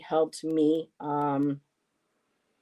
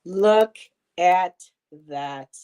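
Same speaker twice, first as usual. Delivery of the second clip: excited; 8 dB louder, much slower than usual. Real, not acted. Showing off the gift.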